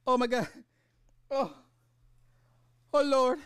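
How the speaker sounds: high-pitched, gasped-out disbelief; shocked reaction. Retold or imitated. Viewer reliving the murder scene, high-pitched horrified exclamations. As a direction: reenact being floored, voice way up high. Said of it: "I was like".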